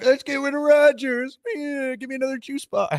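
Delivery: mock a whiny fan begging for juice; mocking whiny kid voice.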